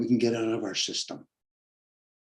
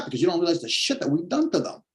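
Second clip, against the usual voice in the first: ordinary talk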